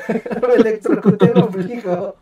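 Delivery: slow, laughing repeat. Real, not acted. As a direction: laugh through it, slowly. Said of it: "¿Cómo carajos te electrocutas el ombligo?".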